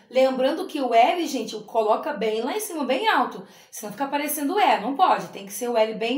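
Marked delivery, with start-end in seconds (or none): none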